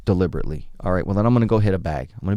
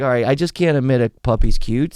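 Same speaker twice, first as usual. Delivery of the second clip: a hint of exasperated surrender, higher and louder; mock giving in; 5 semitones higher, 5 dB louder than usual. Man slapped by his buddy, mock giving in. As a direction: give in with lightly hammed-up exasperation. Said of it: "It's like".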